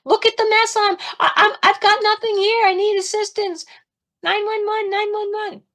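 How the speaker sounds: very high, strained mock yell; re-enacted frantic distress call; demanding help from God. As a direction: plead heavenward in a high squeal. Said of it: "I kept yelling at God".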